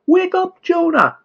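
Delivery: high, loud yell to wake him. A narrator's reading. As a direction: shout him awake from sleep. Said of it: "yelled the captain".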